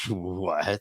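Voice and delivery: low, hushed retold shock; stunned disbelief. Guest retells learning the show is live, quietly stunned. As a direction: drop to a soft, stunned question.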